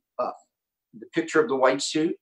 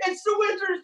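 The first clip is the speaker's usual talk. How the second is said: put-on kids cheering. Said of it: "and they're".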